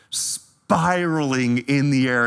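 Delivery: ordinary talk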